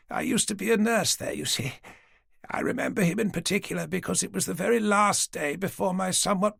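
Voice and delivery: put-on an old lady patiently humouring the police; elderly lady. Dorothy recalling hospital days.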